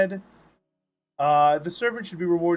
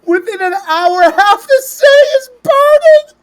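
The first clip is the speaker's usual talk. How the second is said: from steady to soaring loud narration; dramatic narration building; 10 semitones higher, 12 dB louder than usual. Acted. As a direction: build the panic to a high cry. Narrating the fire spreading.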